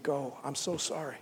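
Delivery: soft, sorry farewell; tender sorrow. Acted. God sending Adam and Eve from the garden, soft and sorry. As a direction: let them go gently, heartbroken.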